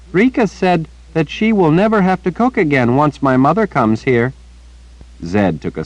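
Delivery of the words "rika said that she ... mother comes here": put-on high, earnest little girl chattering; bright-eyed child repeating gossip to the old wizard. A narrator's reading. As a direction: pitch it up like a young girl telling news. Child Rachel voiced by narrator.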